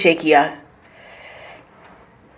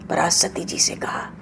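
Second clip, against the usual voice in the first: hushed; 3 semitones lower, 10 dB quieter than usual. Real, not acted. Storyteller drops to a hush.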